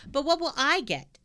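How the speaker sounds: ordinary delivery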